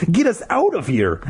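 high, impatient order; acted impatience. A narrator's reading. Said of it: "Ashley repeated impatiently".

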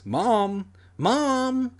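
high-pitched call, rising on the repeat; drawn, high holler; calling for mom. A narrator's reading. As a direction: call out through the house. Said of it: "I shouted into the house".

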